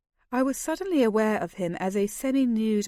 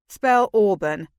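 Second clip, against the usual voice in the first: slow, louder challenge; teasing challenge to a friend; challenging; 7 dB louder, slower than usual. A narrator's reading. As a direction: throw down the challenge slowly and loud. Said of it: "Kent challenged him".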